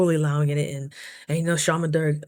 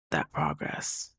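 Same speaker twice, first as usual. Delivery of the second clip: low ominous whisper; 12 semitones lower, 7 dB quieter, slower than usual. Acted. Ominous warning of a thwarting entity.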